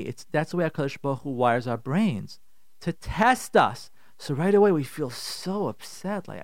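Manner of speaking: ordinary talk, nothing marked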